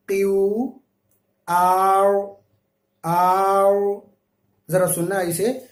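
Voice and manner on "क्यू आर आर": आर said loud, held and heavy; full heavy R. Shown as a demonstration. Teacher showing students a full, held R. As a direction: lean hard into the R of आर. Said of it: "कितना पुर पढ़ रहा हूँ".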